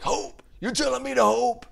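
high, strained angry outburst, hammed-up; mock mad. [name] challenging doctor who says hope, mock mad. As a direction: imitate bad acting of outraged disbelief. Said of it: "gets really mad at God".

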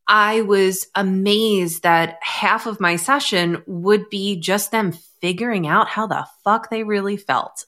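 normal talking voice

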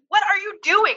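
high reproachful "What are you doing?"; a parent's alarmed reaction; exasperated What are you doing. Retold or imitated. Mom reacting to mud on the glass, exasperated. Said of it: "why did I even say that".

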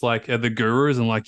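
ordinary delivery

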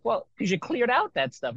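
teacher gently stating the obvious to a puzzled student; knowing reply. Retold or imitated. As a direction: toss the answer off like it's obvious. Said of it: "And it's like".

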